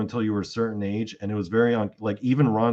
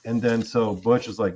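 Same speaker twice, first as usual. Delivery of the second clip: ordinary talk, nothing marked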